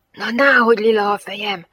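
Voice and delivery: loud, strained stage whisper; full-throated whisper. Acted. Gianetto retorts to the girl about his purple head, full-throated whisper. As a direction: whisper it at the top of your lungs. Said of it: "suttogta torka szakadtából Gianetto".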